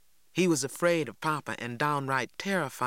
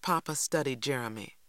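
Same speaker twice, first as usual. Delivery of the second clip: ordinary delivery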